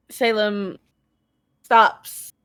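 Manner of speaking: usual speaking voice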